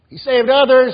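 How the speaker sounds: high loud sneering taunt; mocking jeer. Crowd mocking the crucified Jesus with a jeer. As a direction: jeer it up at the cross.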